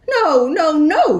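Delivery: high, loud little wolves crying no. Acted. Little wolves refusing the pig, high and loud. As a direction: squeal the no like frightened wolves.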